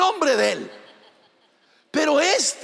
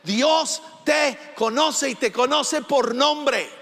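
normal talking voice

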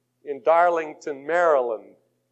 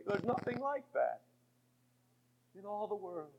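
dropped right down to almost nothing; quiet awe after the storm; hushed; 15 dB quieter than usual. Acted. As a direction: let it fall to a near whisper. Hushed line after the shouting.

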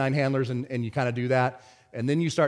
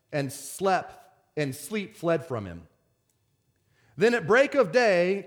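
slow, higher-pitched preacher's reading; scripture reading; 6 semitones higher than usual. Acted. Preacher reads Daniel six to congregation, scripture reading. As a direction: read the verse out with measured weight.

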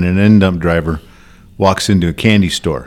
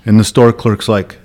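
ordinary talk, nothing marked

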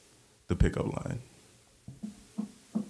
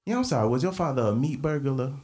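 cheesy pickup line; 3 semitones higher than usual. Acted. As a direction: lay on the charm, then laugh through it. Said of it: "in that voice".

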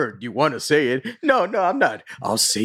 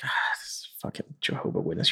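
whispered grumble; annoyed whisper; 7 semitones lower, 9 dB quieter than usual. Retold or imitated. Homeowner hearing a knock, grumbling in a whisper. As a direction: whisper it in irritation.

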